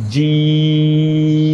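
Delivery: "জিম" with a very long level ee, closing on m; four-alif elongation. Shown as a demonstration. Teacher showing the letter jim, four-alif stretch. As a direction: hold "জিম" on one steady note, very slow. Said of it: "চার আলিফ টান দিতে হবে".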